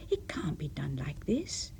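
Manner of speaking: whispered inner thought. A narrator's reading. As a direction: breathe it under your voice.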